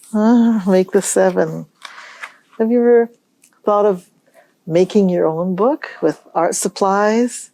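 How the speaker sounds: ordinary talk, nothing marked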